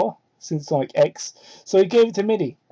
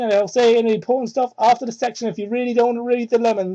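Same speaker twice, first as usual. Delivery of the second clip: plain reading voice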